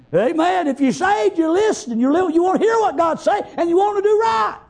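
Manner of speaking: insistent, building pulpit exhortation; fervent preaching. Real, not acted. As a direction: press the point home like a revival preacher. Preaches with force throughout.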